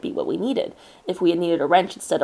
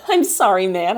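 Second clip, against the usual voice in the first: laughing; 6 dB louder than usual. Acted. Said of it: "I managed to force out amongst my laughter".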